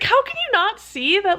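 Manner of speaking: high-pitched exasperated plea, retold; incredulous appeal. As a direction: re-enact pleading disbelief. Retells confronting the committee.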